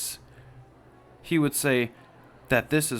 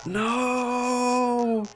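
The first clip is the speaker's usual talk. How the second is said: much higher, loud crying wail, slow at the end; anguished cry; 12 semitones higher, 3 dB louder, much slower than usual. Acted. Friend wails as Schlepp stays silent, high and crying. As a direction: wail it in grief.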